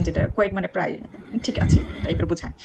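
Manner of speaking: normal talking voice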